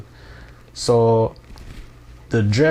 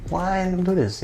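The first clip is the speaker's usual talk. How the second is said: mock bewildered; 9 semitones higher than usual. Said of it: "So I said".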